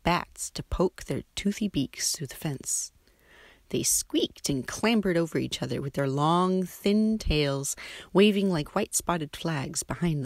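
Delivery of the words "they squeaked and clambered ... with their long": ordinary delivery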